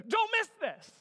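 loud, high, urgent warning; urgent call. Acted. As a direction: demand their attention. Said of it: "a way in Hebrew for the writer to say".

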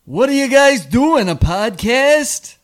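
high, loud, drawn-out character voice; Jaime Mencken. Acted. Host demonstrating his invented character to friends, loud high comic voice. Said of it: "He sounded like".

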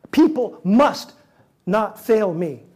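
mock-stubborn insistence, slowed down; insistent demand.

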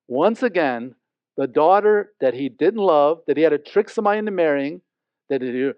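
ordinary delivery

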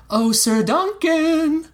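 put-on high, drawn-out boyish call; slow, very high-pitched boy's shout. A narrator's reading. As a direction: sing out from behind like an eager boy. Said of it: "The boy's voice came from behind".

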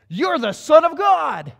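wild men shouting their recognition; demoniacs crying out. Acted. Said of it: "and they go".

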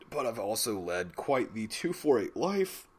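talking through hiccups; a hiccup breaks into the words. Real, not acted. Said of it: "Sorry, I'm hiccuping".

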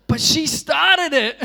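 high, loud, slow whine with laughter; laughing childish protest; whiny kid protest. Retold or imitated. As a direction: plead like a caught child blaming his sister.

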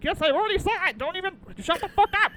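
exaggerated annoyed outburst; mock exasperated yell. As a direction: shout it like you're sick of being asked. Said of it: "I'm like".

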